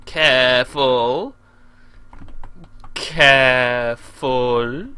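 very slow, stretched, rising at the end; cautious. Real, not acted. Gamer easing the plane along, slow cautious drawl. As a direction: draw it out while concentrating hard.

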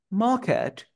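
"market" sin pronunciar la R, con la A alargada; market británico. Shown as a demonstration. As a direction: alarga la vocal de "market" y deja muda la R. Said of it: "en británico". ("market" a la británica, R muda)

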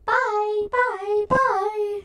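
high, sing-song taunting byes; mocking bye bye. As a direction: tease like the other players. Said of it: "Why do people keep saying".

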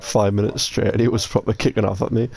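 ordinary talk

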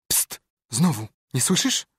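urgent, rising alarmed question; frightened urgency. A narrator's reading. Boys hear noises in graveyard.

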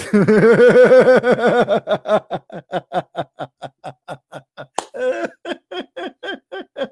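long loud scornful laugh; mocking laughter. Real, not acted. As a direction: burst out laughing at the idea. Mocking the revelation.

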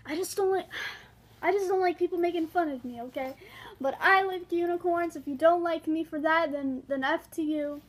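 worked-up defiance about liking unicorns; heated rant. Real, not acted. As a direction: vent real frustration at the teasers. Ranting about teasing.

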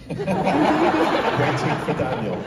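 slow, loud, laughing through it; laughing title. Shown as a demonstration. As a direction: savour the title while laughing. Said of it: "That's what I'm gonna call my memoir".

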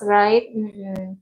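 ordinary talk

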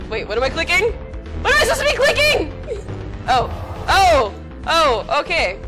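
usual speaking voice

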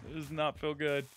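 ordinary talk, nothing marked

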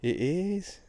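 real jubilant cry, voice shooting up high; excited discovery. Real, not acted. Detectorist tells viewers he found a coin, excited. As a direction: stretch COIN out loud and high in delight. Finds a coin while digging.

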